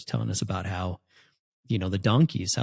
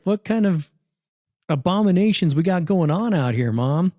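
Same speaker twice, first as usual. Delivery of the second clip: mock disbelief; 7 semitones higher, 3 dB louder than usual. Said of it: "It's like".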